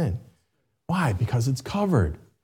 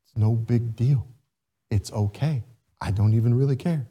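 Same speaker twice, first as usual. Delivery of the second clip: a touch of a soothing parent; 4 semitones lower, 4 dB quieter than usual. Retold or imitated. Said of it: "and you tell them".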